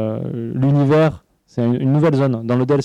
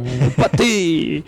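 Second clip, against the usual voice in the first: mock pâté-obsessed character; 3 semitones higher, much slower than usual. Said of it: "qui est omnubilé par le pâté".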